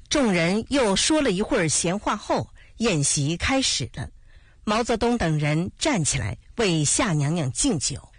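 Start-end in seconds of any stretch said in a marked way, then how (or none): none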